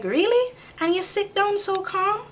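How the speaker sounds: high, amazed quoting of a surprised nurse; mock amazement, voice jumping way up. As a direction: squeal it like you can't believe it. Nurse amazed she's calm.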